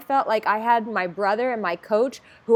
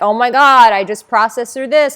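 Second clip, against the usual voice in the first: loud, eager phone-call outburst; excited exclamation; 10 dB louder than usual. Retold or imitated. Calling her coach with news, excited and loud. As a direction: burst out with breakthrough news.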